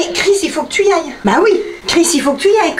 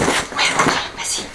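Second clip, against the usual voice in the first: whispering while hiding; 7 dB quieter than usual. Real, not acted.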